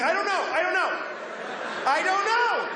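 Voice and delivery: a hint of flustered panic at the drive-thru window; panicked repeating. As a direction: babble it over and over in a panic. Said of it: "I just kept saying".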